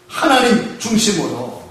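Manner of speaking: ordinary talk, nothing marked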